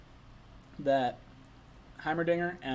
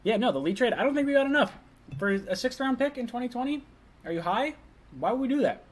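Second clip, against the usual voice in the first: incredulous fan rant; 10 semitones higher, 4 dB louder than usual. Real, not acted. Fan ranting about a trade.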